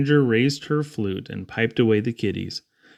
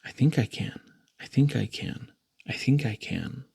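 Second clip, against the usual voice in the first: breathy straining whisper; whispered as if out of puff; whispered soft; 3 semitones lower, 6 dB quieter than usual. Acted. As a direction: whisper it while climbing a hill. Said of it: "he whispered soft".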